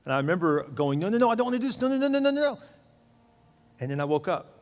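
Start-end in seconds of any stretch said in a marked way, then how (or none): 1.0-2.6 higher-pitched pleading no's; alarmed protest. Retold or imitated. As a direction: resist in panic, pitch up. Protesting inside a dream.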